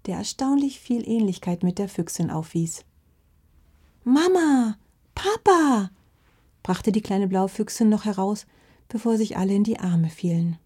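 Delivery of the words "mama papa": high, drawn-out glad call; joyful astonished cry. A narrator's reading. Little fox finding her lost parents, astonished cry. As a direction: cry out in happy recognition.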